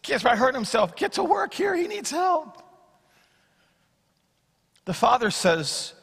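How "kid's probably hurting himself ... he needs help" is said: high, fast, urgent nudge; urgent plea. Pretend. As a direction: hurry him up, pitched way up. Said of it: "You kinda wanna say".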